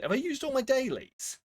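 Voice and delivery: incredulous, high and rising; surprised. Real, not acted. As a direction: realise it in shock, pitch jumping up. Realises daily puzzles are used up.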